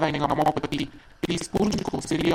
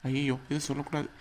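hushed aside; 4 semitones lower, 8 dB quieter than usual. Acted. Husband catches his slip, aside.

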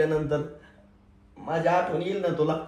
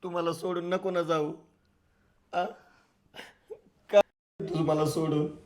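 tearful; 5 dB quieter than usual. Acted. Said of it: "त्याच्यासाठी रडतोयस तू?".

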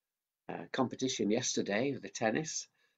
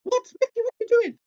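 much higher squeaky puppet; 11 dB louder, faster than usual. Acted. Mickey the puppet cutting in, high-pitched. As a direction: make the puppet pipe up.

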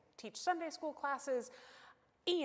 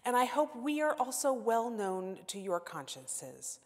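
louder, proclaiming reading voice; scripture reading; 5 dB louder than usual. Acted. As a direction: read it out to the whole room. Reads scripture to congregation.

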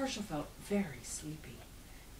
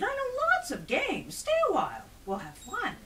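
high, coaxing plea; eager begging that eases at the end; pleading; 9 dB louder than usual. A narrator's reading. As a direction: coax a departing guest to stay. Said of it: "Herschel pleaded".

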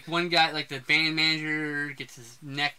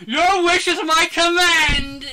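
high, loud, drawn-out movie-hero cry; over-the-top imitated battle cry; booming mock proclamation; 11 semitones higher, 8 dB louder, slower than usual. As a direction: belt it out slowly like a hammy film climax. Acts out the movie's climactic line.

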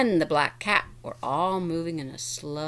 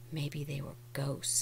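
whispering; 4 semitones lower, 10 dB quieter than usual. Acted. Spooky suggestion of ghosts.

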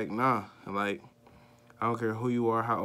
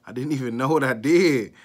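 loud, higher-pitched retold protest; raised voice, acting out panic; exasperated outburst; 4 semitones higher, 12 dB louder than usual. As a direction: burst out in bewildered protest. Retelling his panicked thoughts.

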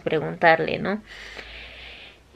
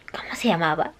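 a hushed whisper searching memory; whispered to herself; 3 semitones lower, 5 dB quieter than usual. Retold or imitated. Nervous student whispering to herself trying to recall a name. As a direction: whisper it as if racking your brain.

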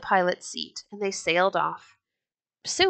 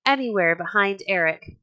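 ordinary delivery, nothing marked

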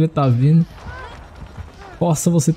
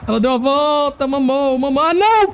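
dismayed whine; 13 semitones higher than usual. Real, not acted. He is caught by the killer.

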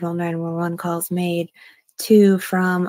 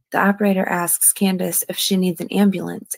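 usual speaking voice

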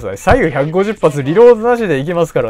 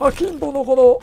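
Hiraizumi Sei impression; 10 semitones higher than usual. Gamer presenting akimbo guns, high-pitched actor impression. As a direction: do the high mimic voice, stretching the last syllables. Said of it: "ちょっと真似してたんですよね".

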